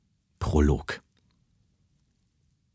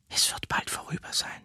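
soft, soothing whisper; 8 dB quieter than usual. Acted. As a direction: breathe it out, comforting them. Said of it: "Sie senkte ihre Stimme".

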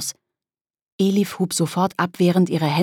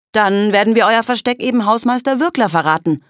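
louder, higher, triumphant threat; threatening; 5 semitones higher, 5 dB louder than usual. Acted. Kolja threatens to tell the caretaker, triumphantly. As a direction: play your trump card and threaten them. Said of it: "drohte Kolja".

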